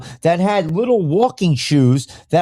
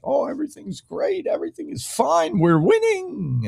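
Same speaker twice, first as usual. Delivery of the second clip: mock complacent voice; 10 semitones higher, 4 dB quieter than usual. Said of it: "be like".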